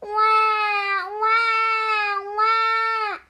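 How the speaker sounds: high, loud, sustained wahs; imitated baby wailing. A narrator's reading. Farmer's baby wakes at sunrise, loud high wailing. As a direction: wail like a storybook baby. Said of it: "Farmer's baby woke up".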